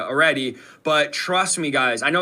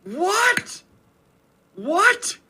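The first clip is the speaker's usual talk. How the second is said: a much higher, stunned "What?"; disbelief. Real, not acted. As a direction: react in sheer disbelief, twice. Shocked by director's admission.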